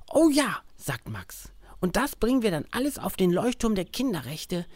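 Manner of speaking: put-on high, eager little boy; child voice. Max is excited about breakfast. (neugierige Kinderstimme)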